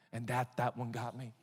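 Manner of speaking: usual speaking voice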